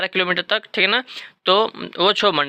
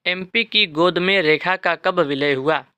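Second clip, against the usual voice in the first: slow, spelled-out memory rhyme; slow mnemonic recital; slower than usual. Shown as a demonstration. Teacher slowly dictating the river mnemonic to students. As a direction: lay out each word of the trick slowly. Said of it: "नदियों का trick है".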